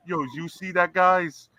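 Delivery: quick, high Brooklyn tough guy; Brooklyn accent. Retold or imitated. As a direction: toss it off like a Brooklyn wiseguy. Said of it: "dinosaurs were speaking with a Brooklyn accent".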